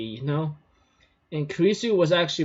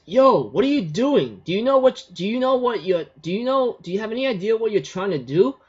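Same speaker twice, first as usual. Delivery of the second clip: Kurisu confronting; 7 semitones higher, 4 dB louder than usual. Retold or imitated. Kurisu confronts Okabe, retold argument.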